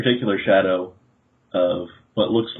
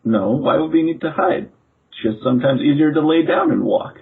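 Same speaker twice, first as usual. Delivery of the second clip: put-on higher-pitched, innocent boy's voice; puzzled shadow boy; 7 semitones higher, 4 dB louder than usual. Shadow boy explains himself to the visitor, puzzled.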